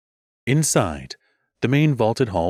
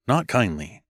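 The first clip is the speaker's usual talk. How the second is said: plain reading voice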